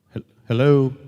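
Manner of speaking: insistent, loud hello. Real, not acted. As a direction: call out to someone not hearing. Repeats hello louder into phone.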